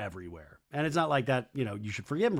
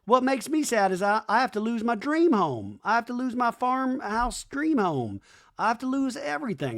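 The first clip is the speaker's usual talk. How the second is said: imitating Schwartz; 9 semitones higher than usual. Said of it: "And Schwartz is like".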